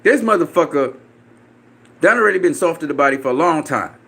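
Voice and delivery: normal talking voice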